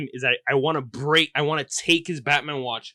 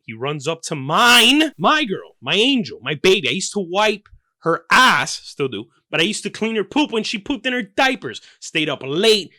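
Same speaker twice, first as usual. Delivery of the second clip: louder, higher indignant rant; rising outrage; 6 semitones higher, 5 dB louder than usual. Acted. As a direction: build the dad's outrage up.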